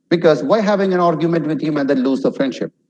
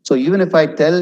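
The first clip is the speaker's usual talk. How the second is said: ordinary talk, nothing marked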